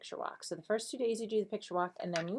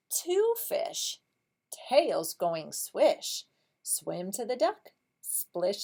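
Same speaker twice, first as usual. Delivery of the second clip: picture-book reading; 2 semitones higher, 4 dB louder, much slower than usual. Shown as a demonstration. Teacher reads about fish helping the duck, lively slow storybook voice. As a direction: read it slowly, bouncing on the rhymes.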